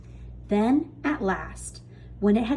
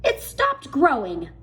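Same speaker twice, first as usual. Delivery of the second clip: cry; 5 semitones higher, 4 dB louder than usual. A narrator's reading. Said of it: "Aunt Spiker cried".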